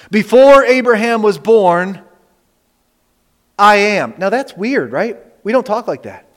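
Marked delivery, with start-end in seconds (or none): 0.1-4.1 slow, loud declaration with a held pause; forceful proclamation. Retold or imitated. Preacher requoting Jesus' claim to the congregation, loud and deliberate. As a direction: proclaim the quote with deliberate weight.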